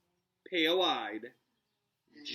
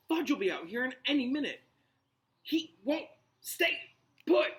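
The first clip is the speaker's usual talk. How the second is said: higher, fast urgent exchange; anxious character voices; 10 semitones higher, 5 dB louder than usual. Acted. Friends argue in hushed panic, higher and urgent. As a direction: push it high and hurried. Hermione frantic, Ron retorts.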